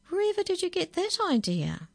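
very high, incredulous; astonished question. Acted. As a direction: ask in playful disbelief. Nana amused, asks granddaughter.

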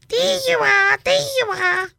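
very high, loud squawky parrot. Acted. Parrot talking.

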